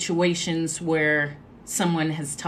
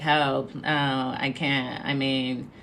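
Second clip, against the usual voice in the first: much lower, even, droning voice with no lift; monotone friend; 8 semitones lower, 5 dB quieter than usual. Retold or imitated. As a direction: drone out every excuse on one low note. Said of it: "she speaks in this very monotone voice".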